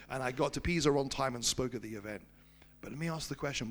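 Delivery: normal talking voice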